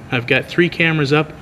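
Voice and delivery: ordinary delivery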